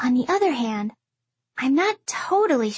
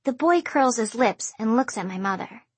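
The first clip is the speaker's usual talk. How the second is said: ordinary delivery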